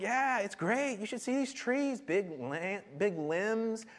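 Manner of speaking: high-pitched, eager mock replies; enthusiastic worshippers. People defend their shady trees to God, mock enthusiasm. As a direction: play gushing fans of the trees. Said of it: "They're like".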